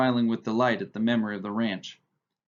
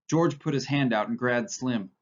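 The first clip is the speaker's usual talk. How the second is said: ordinary delivery, nothing marked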